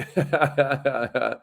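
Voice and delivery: amused chuckle trailing down; hearty laugh. Real, not acted. Livestream host amused by a viewer's comment, laughing. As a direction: laugh in a steady run of ha-ha-has.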